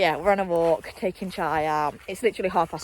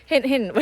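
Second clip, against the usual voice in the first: "Hint, hint" said higher and louder, nudging; teasing hint; 5 semitones higher, 5 dB louder than usual. Real, not acted. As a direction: push "Hint, hint" as a sly clue.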